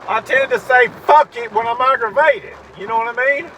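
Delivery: real angry yelling. Real, not acted. Venting to the camera about doctors, yelling in real anger. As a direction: shout it out, fed up and boiling over. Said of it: "I'm getting uptight and I'm yelling".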